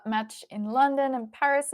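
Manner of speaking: usual speaking voice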